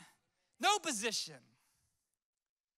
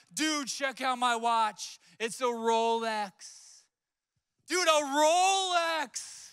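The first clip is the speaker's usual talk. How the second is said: excited friend; 3 semitones higher, 6 dB louder, much slower than usual. Retold or imitated. Friend shows off his market Rolex, loud excited drawl. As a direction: show off the fake Rolex in awe. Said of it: "He was so excited".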